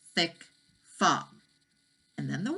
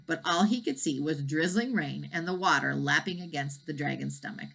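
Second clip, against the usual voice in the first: ordinary delivery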